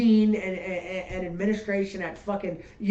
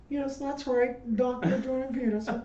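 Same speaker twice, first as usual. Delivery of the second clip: prim correction; 5 semitones higher, 4 dB quieter than usual. Retold or imitated. Imitating Peterson at DMV.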